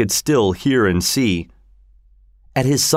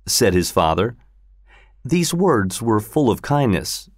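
ordinary delivery, nothing marked

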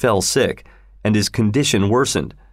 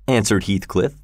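usual speaking voice

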